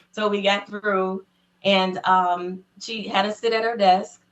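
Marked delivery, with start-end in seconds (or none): none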